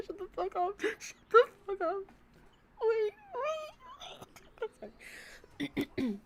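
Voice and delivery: high, squealing through laughter; real laughing fit; cracking up. Real, not acted. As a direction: break into helpless laughter mid-sentence. Breaks character laughing at the bit.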